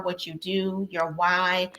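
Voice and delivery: normal talking voice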